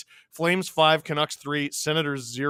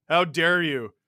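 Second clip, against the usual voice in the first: normal talking voice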